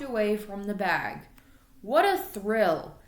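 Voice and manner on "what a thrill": usual speaking voice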